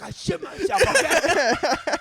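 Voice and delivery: high, loud yelp through laughter; laughing yell. Retold or imitated. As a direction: blurt it out cracking up. Yelled confession while laughing.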